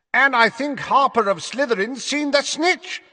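high, excited match commentator; megaphone commentary. A narrator's reading. Commentator announcing the snitch sighting to the crowd, high megaphone call. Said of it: "said Zacharias Smith through his megaphone".

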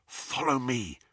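soft hushed order; whisper. A narrator's reading. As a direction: whisper it to the frightened group. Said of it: "he whispered to the group".

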